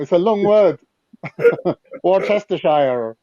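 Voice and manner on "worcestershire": slow, every-letter "Worcestershire"; American Worcestershire. Shown as a demonstration. Friend drawing out the long word. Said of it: "trying to get the word out".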